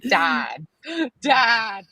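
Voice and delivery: loud mock-scandalised call of Dad; mock protest. Scolding her father over his joke, mock outraged shout.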